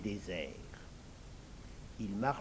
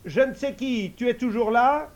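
higher, louder unsure call; hesitant idiot calling; 9 semitones higher, 9 dB louder than usual. Acted. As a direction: try the magic call timidly. Idiot checks.

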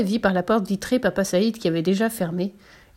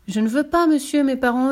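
higher, earnest objection; lightly voiced small devil, pitched up; protesting little devil; 5 semitones higher, 4 dB louder than usual. A narrator's reading. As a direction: object like a worried child.

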